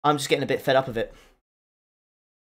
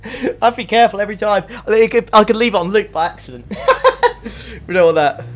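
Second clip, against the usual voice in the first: higher voice through real laughter; amused, laughing admission; 5 semitones higher, 4 dB louder than usual. Real, not acted. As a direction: laugh while talking, breaking up at the end. Laughing at own near slip on stream.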